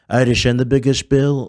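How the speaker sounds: lightly put-on boastful politician; Donald Trump. Impersonator mocking those who think he governs, Trump voice. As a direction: brag in an impersonator's slurred-s voice. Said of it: "I'm not actually Trump".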